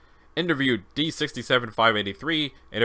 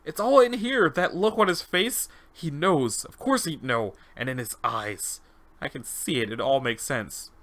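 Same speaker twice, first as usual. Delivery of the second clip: subject having a revelation; 2 semitones higher, slower than usual. Acted. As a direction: let the truth dawn on you. Subject has a slow revelation.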